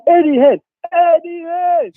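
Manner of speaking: drawn-out high call, slow. Acted. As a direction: stretch his name out like a challenge. Calling out Eddie Hearn.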